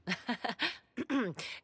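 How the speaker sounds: amused, scoffing chuckle; mocking laugh. Sorceress laughing at the Inquisitor's confident plan, mocking chuckle. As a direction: laugh off their plan as naive.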